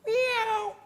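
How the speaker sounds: high, squeaky put-on meow; escaping prisoner faking a cat's meow, high and loud. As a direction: pretend to be a cat to fool the guards. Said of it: "and he says".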